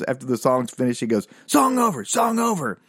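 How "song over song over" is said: high, punchy mock shout; lead singer's call. Storyteller as lead singer ending the song, high mock shout. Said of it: "the lead singer goes".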